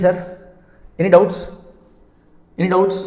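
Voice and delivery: normal talking voice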